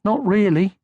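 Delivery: high, gentle motherly voice; kindly mother troll; Moomin Mama. Acted. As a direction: soothe him like a calm mother.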